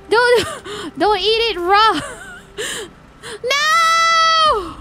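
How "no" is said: high wailing No!; despairing yell; anguished shout. Real, not acted. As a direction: cry out in dismay. Friend eats fish raw.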